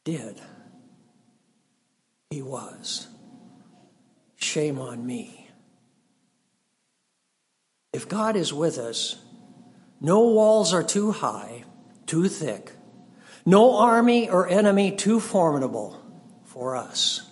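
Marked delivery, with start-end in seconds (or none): 0.0-5.5 quiet. Real, not acted. Quiet self-rebuke after outcry. 10.0-17.2 preaching. Acted. Preaching build on God with us.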